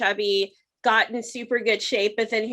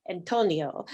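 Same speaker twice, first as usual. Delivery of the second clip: attempted Latin accent, lower and playful; 5 semitones lower, 5 dB quieter than usual. Podcaster mimicking a contestant's way of saying Antonio, attempted Latin accent. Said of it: "nice little, like, Latin accent".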